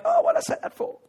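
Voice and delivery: high, strained self-reproach; mock regret. Someone regretting a rash public promise, high mock dismay. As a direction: groan it as if kicking yourself, hammed-up.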